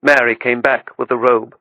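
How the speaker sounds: ordinary delivery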